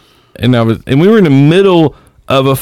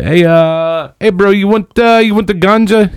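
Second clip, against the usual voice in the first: higher Bahamian street seller; lightly voiced Bahamian hustler; Bahamian accent; 8 semitones higher than usual. Bahamian seller offering ganja, light Bahamian accent. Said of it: "They say".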